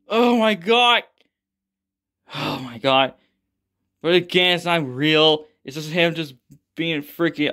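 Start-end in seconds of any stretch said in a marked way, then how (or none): none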